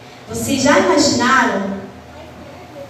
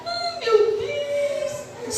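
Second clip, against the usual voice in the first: drawn-out sing-song wail, high and soft; mock weeping at a slow pace; demo crying; 10 semitones higher, 7 dB quieter, much slower than usual. Shown as a demonstration. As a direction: show the kids how to cry, slowly. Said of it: "Vamos chorar".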